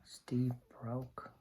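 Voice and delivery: hushed whispered reveal. Pretend. Revealing to the children what the storm did, whispered. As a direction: whisper the sad news.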